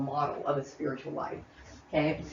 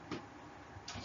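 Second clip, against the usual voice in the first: whispered; 21 dB quieter than usual. Real, not acted. Drops to breathy near-whisper.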